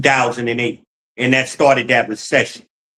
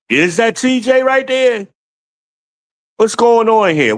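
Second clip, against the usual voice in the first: sudden high shout of disbelief; a real jolt, calling out at a comment on screen; startled; 8 semitones higher than usual. Real, not acted. Reading chat, streamer yells in sudden alarm.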